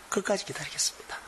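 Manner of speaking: hushed whispered vow; whispered resolve to wait. Retold or imitated. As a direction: breathe the promise out in a whisper. Job's vow repeated, whispered.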